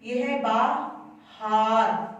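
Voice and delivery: बहार drawn out very slowly; slow model word. Shown as a demonstration. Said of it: "तलफ्फुज की अदायगी".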